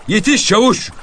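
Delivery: shout. Acted. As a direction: call out back to the sergeant in a dare. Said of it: "diye bağırdı".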